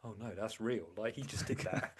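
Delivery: hushed mock amazement; awed hush. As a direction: drop the voice as if gobsmacked. Stunned bodybuilders retold.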